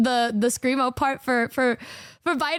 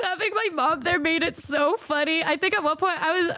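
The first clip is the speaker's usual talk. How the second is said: usual speaking voice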